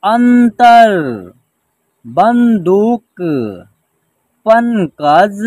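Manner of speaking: measured reading-lesson delivery, long held syllables; word-by-word pronunciation drill. Shown as a demonstration. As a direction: stretch each word for learners to repeat. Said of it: "एक साथ उच्चारण करेंगे".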